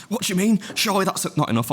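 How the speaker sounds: high, incredulous imitation; mock disbelief. Imitating a gym mate scoffing at his lift, high and incredulous. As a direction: sound shocked it's so little.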